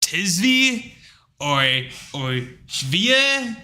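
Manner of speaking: clerk butchering the name Tizvi?; mangled name. Retold or imitated. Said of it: "and then they go".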